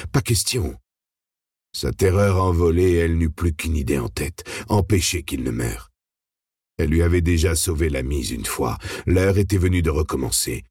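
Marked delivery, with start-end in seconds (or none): none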